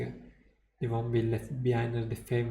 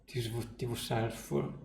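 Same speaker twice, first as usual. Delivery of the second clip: plain reading voice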